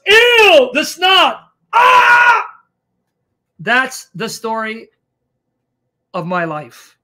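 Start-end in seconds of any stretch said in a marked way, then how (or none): 0.1-2.4 imitating a loud, high squeal of disgust; disgusted shriek. Friend Pete reacting to snot on her shoulder, loud disgusted shriek. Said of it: "And he goes".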